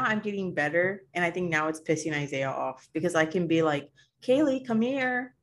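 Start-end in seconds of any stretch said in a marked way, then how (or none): 4.2-5.3 high, slow sing-song call; a mom calling her daughter, high and drawn out; calling child. Retold or imitated. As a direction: call the kid sweetly.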